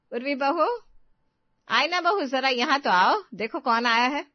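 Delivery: loud, high summoning call; cheery mother-in-law calling the daughters-in-law. Acted. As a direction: call them in from another room. Mother-in-law calls both to meet guests.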